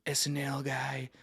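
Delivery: whispered wannabe; hushed mock of a comedian wannabe. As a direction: breathe it like a secret wish. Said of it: "He's like".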